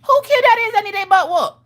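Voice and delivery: high, loud scolding auntie voice; a nosy neighbor ready to scold; mock outraged auntie, high pitched. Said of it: "you gonna be like".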